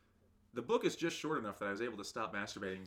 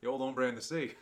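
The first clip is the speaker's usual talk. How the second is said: mock gangster; 3 semitones higher, 7 dB louder than usual. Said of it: "those are Latin kings".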